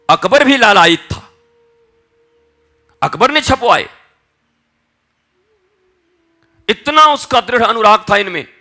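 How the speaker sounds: loud, high proclamation to a crowd; impassioned preaching. Acted. Preacher exalting Surdas's writings to the congregation, impassioned preaching. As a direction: declaim it at full force, pitched high.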